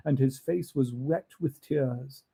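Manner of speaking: usual speaking voice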